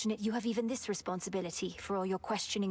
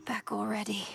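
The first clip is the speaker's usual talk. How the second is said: whisper. Acted. Game character whispers line.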